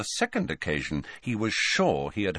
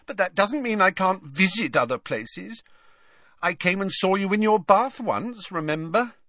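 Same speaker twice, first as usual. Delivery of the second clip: put-on high-pitched, sulky girlish voice; very high, pouting ghost girl; 11 semitones higher than usual. A narrator's reading. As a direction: sulk at them in a high whine. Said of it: "with a sulky little shrug".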